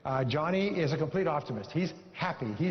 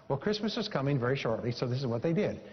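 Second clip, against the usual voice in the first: ordinary delivery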